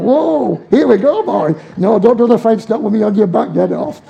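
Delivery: loud high yelps of a man clinging to a bucking sheep; mock rodeo cries on a big sheep; riding a struggling sheep. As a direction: whoop and hang on for dear life. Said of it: "It's like".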